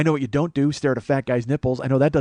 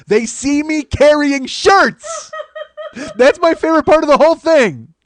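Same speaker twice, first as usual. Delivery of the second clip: much higher, loud, slow triumphant yell; gleeful shout; 14 semitones higher, 10 dB louder, much slower than usual. Real, not acted. Comedian reliving his shirtless strut, gleeful high yell. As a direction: crow it with laughing glee.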